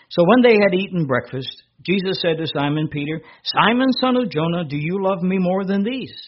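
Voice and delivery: lifted, ringing scripture voice; scripture reading. Acted. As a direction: proclaim the Gospel to the congregation. Reads John 21 aloud.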